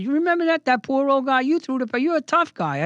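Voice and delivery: high, insistent dig; taunting. Retold or imitated. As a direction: rub his nose in it. Taunting the bully in retelling.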